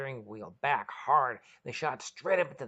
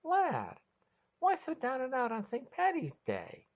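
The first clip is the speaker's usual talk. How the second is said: high, teasing lady's voice; a playful high question; mischievous inquiry; 13 semitones higher than usual. Acted. Maggie teasing a sad Irishman, high and mischievous. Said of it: "Maggie mischievously inquired".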